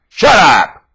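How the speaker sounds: loud barked shut up; angry shout. Acted. Preacher shouting down the objector, a loud bark. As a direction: bark it at them. Sudden outburst in rant.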